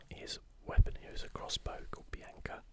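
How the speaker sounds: usual speaking voice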